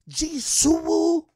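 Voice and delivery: high, slow, drawn out Suwu; girlfriend's voice. Retold or imitated. Girlfriend asking to take the car, high and slow. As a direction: stretch the name out like a girl asking. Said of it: "She was like".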